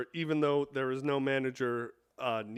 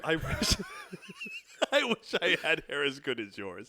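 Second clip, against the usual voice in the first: 1 laughing; 4 semitones higher than usual. Real, not acted. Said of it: "What are you laughing about?".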